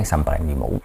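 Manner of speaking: low, soft imagined morning craving; mock craving, understated. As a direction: murmur it low, like a sleepy thought. Acts out someone waking up craving marshmallows.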